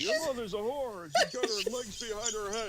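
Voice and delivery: put-on high old man voice; South Park grandpa. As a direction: beg like a cartoon grandpa. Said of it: "Grandpa's trying to get someone to kill her".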